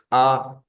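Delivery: A, mouth wide and voice lifted; open a sound. Shown as a demonstration. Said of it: "by opening the mouth and raising the voice".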